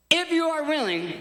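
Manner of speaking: high-pitched cry to Jesus; pleading cry. Acted. Leper begging Jesus to heal him, high cry. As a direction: call out in earnest appeal.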